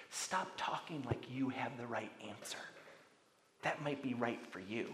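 a whispered, dismissive voice of the culture; hushed mock whisper. Preacher voices the relativist culture in a hushed whisper.

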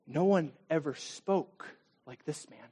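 quiet, slow reverent reply; awed hush. Pretend. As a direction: say it in quiet wonder.

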